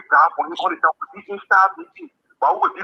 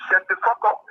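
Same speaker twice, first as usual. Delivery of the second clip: high, sharp retold yell, pitched way up; retold outburst; 13 semitones higher than usual. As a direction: replay the shout from the video, higher and sharper. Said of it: "you hear me saying".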